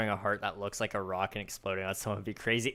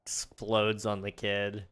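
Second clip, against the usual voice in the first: normal talking voice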